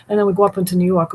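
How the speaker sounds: fast, loud New York accent. Shown as a demonstration. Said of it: "of course, because it's New York".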